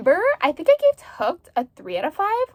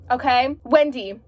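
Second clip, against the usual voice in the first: ordinary delivery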